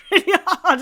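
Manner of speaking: loud, fast laughing remark. Real, not acted. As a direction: laugh through it. Laughing at the exercise.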